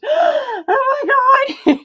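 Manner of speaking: drawn-out, high, loud gasping "Oh my God"; shocked gasp. Retold or imitated. Retelling how everyone gasped at her fabric, drawn-out shocked cry. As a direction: gasp it out like you're stunned. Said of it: "everybody goes".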